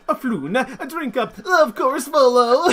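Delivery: high, loud nasal voice for Floon; nasally voice. Retold or imitated. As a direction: pinch it through the nose, laughing. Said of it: "the nasally voice for Floon".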